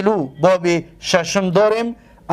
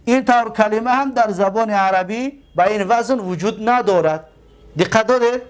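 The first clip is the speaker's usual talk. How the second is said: normal talking voice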